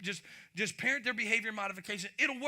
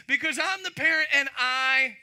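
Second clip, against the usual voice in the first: loud, higher, bossy parent line; mock parent shout; 7 semitones higher, 12 dB louder than usual. Parent to a child, pulling rank with a loud shout. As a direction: bark it like a fed-up parent. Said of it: "maybe you said it as a parent".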